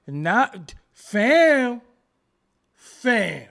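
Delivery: loud, incredulous repeated fam; loud disbelief. Real, not acted. Streamer shouting at a chatter's username, loud disbelief. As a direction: call out the chat in loud disbelief.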